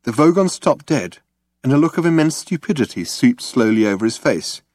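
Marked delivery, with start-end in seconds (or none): none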